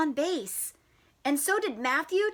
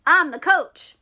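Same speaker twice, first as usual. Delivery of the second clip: loud; 4 semitones higher, 8 dB louder than usual. Acted. Dad answers Froggy.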